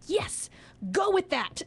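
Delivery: high, emphatic cheer of approval; excited encouragement. Retold or imitated. Drama teacher to student with a bird idea, excited encouragement. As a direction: urge the student on with delight.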